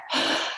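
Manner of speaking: one long audible sigh out; demo deep breath. Shown as a demonstration. Parent showing a child a calming breath, sighing it out. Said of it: "Let's take a breath".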